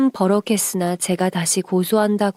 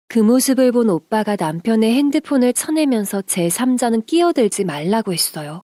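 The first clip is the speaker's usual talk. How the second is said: usual speaking voice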